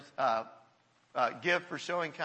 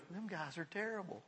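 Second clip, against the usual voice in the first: blunt aside; 13 dB quieter than usual. Retold or imitated. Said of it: "he goes, and pardon me".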